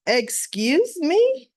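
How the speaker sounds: slow, high, offended drawl; indignant excuse me. Retold or imitated. Drew affronted at being summoned by Nina. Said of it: "Drew was like".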